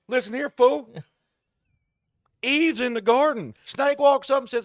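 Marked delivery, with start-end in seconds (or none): 0.1-0.9 high, loud cheeky put-down; the donkey scolding its master; mock donkey snapping back; sassy donkey. Donkey voiced in retelling.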